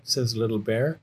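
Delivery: ordinary delivery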